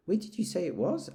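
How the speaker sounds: Pooh, alarmed; anxious bear. Acted. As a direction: ask with a guilty start. Pooh asks Eeyore.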